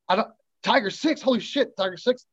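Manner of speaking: high, rising disbelief; stunned as it dawns on him; shocked realization. Retold or imitated. Realizing base commander came.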